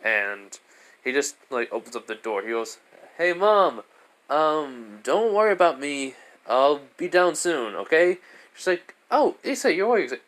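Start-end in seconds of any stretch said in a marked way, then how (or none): 3.2-8.2 put-on raised, louder eager teen voice calling out; cheery son. Son calling to his mom downstairs, raised and eager. 9.1-10.0 much higher, louder motherly voice; mom voice. Acted. Mom greeting her son upstairs, high motherly voice.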